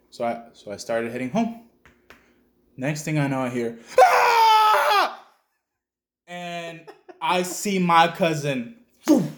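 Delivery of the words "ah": sudden loud high scream; retold terrified scream with laughter. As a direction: let out a piercing shriek. Cousin attacked at the river.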